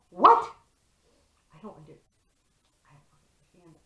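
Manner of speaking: a loud "What?" fading to a whispered confusion; baffled to whisper. Acted. Performing the trip confusion, a loud stunned what that fades to a whisper.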